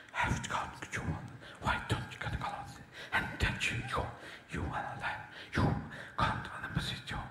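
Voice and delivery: unvoiced mumbled horse whisper. A horse whisperer murmuring to the horse in a whisper. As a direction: breathe the words to the horse. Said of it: "horse whisperers".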